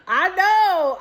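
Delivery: high, loud squeal of agreement; excited I know. Real, not acted. Agreeing eagerly with her friend on FaceTime, excited squeal. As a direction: squeal it with delight.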